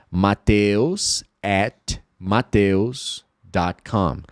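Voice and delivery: slowly reading an email address aloud; slow spelled email. Shown as a demonstration. Teacher demonstrating an email address slowly. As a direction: read each piece slowly. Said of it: "eu quero falar".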